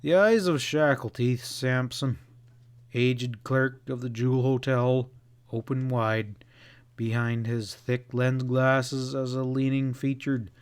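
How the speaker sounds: ordinary delivery, nothing marked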